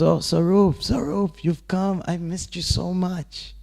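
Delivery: high, eager cry of welcome; overjoyed greeting. Retold or imitated. Krishna welcoming his returning friend, high and delighted. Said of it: "he goes".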